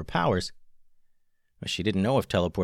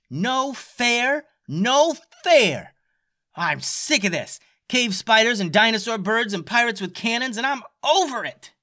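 fed-up tantrum; 13 semitones higher, 4 dB louder than usual. A narrator's reading. Stella throwing a fit.

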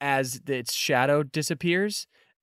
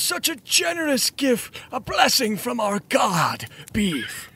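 worshipping cultist; 9 semitones higher than usual. Acted. GM voices grateful cultist.

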